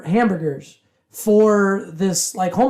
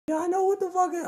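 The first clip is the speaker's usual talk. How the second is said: mock complaint; 8 semitones higher, 10 dB quieter than usual. Imitating homeless man's complaint.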